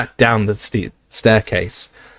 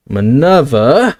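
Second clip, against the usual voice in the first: very slow, louder gasped name; aghast; 3 semitones higher, 4 dB louder, much slower than usual. Acted. Slughorn, aghast at Minerva, slow and loud. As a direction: draw the name out in shock. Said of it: "he said aghast".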